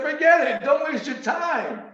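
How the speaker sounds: high, slow brush-off, mocking the idea; dismissive son. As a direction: wave it off like a know-it-all son. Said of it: "He said".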